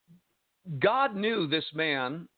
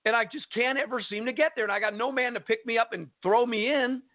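quick, higher-pitched frustrated complaint; 4 semitones higher, faster than usual. Acted. Sick man explains to Jesus why he stays unhealed, frustrated. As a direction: voice the sick man's mounting exasperation, easing at the end. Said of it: "the frustration in this man's voice".

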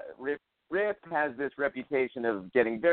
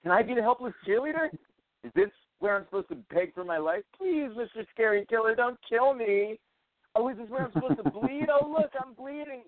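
high, fast, hammed-up panic; hammed-up scream-queen terror, high and breathless; mock-panicked cheerleader; 9 semitones higher than usual. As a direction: squeal like a helpless horror victim. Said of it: "what she says".

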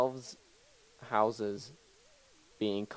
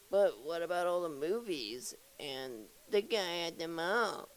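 higher, protesting mock kid voice; imitating own kid; 5 semitones higher, 3 dB quieter than usual. Kid still doubting dad about Santa, in a higher child voice. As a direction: play a kid pushing back on dad. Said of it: "my kid's like".

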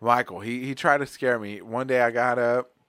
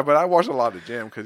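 usual speaking voice

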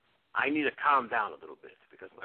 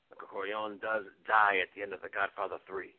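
normal talking voice

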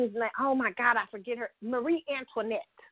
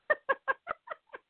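high laugh. Real, not acted. Laughs after her rant.

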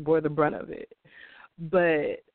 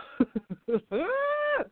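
sudden high burst of laughter; real high-pitched laugh. Real, not acted. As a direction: cackle at your own punchline. Laughs at her own joke.

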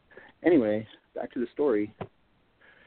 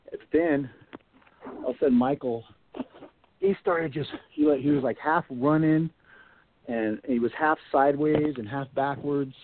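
ordinary delivery

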